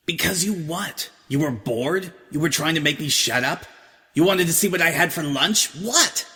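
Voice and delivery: rising insistent questions; Vinyl, pressing. Acted. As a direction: push her for an answer.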